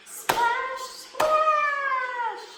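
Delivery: high, sung splash calls; rising playful splash calls. Shown as a demonstration. Host leading kids in the splash game, sung high. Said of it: "biggest splash of all".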